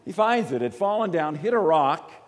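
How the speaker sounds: high, loud burst of delight; a happy exclaim over the found ring; excited. Real, not acted. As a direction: announce the find with glee. Excited retelling of the find.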